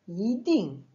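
"yiding" rises on yi, falls sharply on ding; deuxième puis quatrième ton. Shown as a demonstration. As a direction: lift yi then drop ding in "yiding". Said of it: "I deuxième ton, comme dans". (« yiding » : yi montant, ding descendant)

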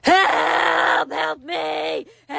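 long drawn-out mock cry for help; calling for help. Old woman crying out for help, drawn-out wail. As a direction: wail for rescue.

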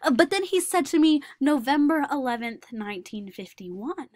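put-on earnest young man recalling school, wistful; Daniel remembering childhood. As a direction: tell the memory as the lonely boy grown up. Performer plays Daniel Tammet.

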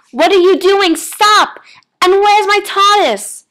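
high, loud, frantic protest; yelled. A narrator's reading. Man yanking at tree cuffs, frantic high yell. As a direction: yell out in alarm. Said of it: "he yelled".

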